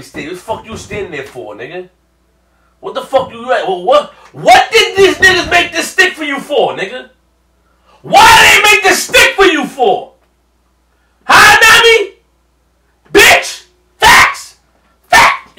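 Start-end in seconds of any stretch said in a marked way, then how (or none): 2.8-12.2 higher, louder, rising into an angry yell; yelling at Nami. Real, not acted. Fan yelling at Nami for letting Luffy fight alone, furious shout. 13.1-15.6 short, loud bursts; loud outburst. Real, not acted. Fan shouting agreement at the screen, loud bursts.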